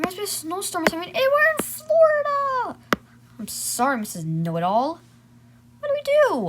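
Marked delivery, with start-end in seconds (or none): 1.1-2.8 high, loud indignant protest; indignant shout. Acted. Arguing with know-it-all sibling. 5.8-6.5 high, loud panicked plea; panicked cry. Acted. Kids panicking about the cold.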